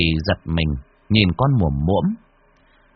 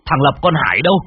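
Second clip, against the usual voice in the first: louder and higher, building to a panicked shout; frantic calling; 6 semitones higher, 5 dB louder, faster than usual. Acted. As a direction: call the whole house out in terror. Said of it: "Nghe tiếng ông chủ gọi". (gọi ầm lên hoảng hốt, to dần)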